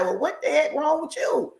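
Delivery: mock high nosy voice, jumping up on so; teasing imitation of someone's remark. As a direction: poke fun at a nosy stranger. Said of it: "and they like".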